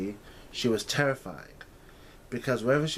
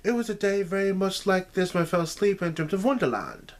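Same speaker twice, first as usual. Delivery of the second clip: put-on higher, louder girlish voice, remembering with excitement; young Alice, eager; 9 semitones higher, 4 dB louder than usual. A narrator's reading. Alice realizing the day matches her dream, excited girlish voice. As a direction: let the memory spill out brightly.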